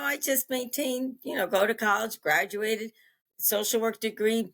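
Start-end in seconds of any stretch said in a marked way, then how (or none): none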